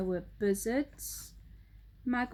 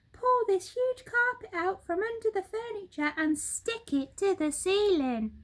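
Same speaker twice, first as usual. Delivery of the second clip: high, bright-pitched excited monkey voice; Mugglewump the monkey; 10 semitones higher, 4 dB louder than usual. Acted. Monkey father showing birds the living room, high excited voice. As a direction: chatter it out high and quick like an eager monkey.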